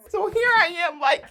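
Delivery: high loud burst of laughter; real laughter; big laugh. Real, not acted. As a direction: crack up completely. Laughing hard.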